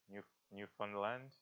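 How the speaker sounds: soft, unsure "Newfoundland?" said as NEW-fun-land, rising; tentative pronunciation check. Shown as a demonstration. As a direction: try "Newfoundland" with the middle reduced to "fun", questioning. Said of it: "how do you say? What's the right way to, to pronounce it".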